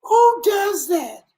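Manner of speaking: high, cracking laugh-shout; laughing high outcry. Real, not acted. Speaker cracks up at own story, laughing shout. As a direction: shriek it through helpless laughter.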